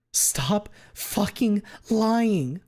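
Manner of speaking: slow, high furious demand; angry. Acted. Fang accuses her friend of lying, slow, high and furious. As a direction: drag out each word in fury. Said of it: "She's angry again".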